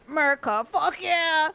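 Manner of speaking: "Murica" catchphrase, punched out; hammed-up American pride; mock patriot cheer. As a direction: throw in a hammy patriotic cheer.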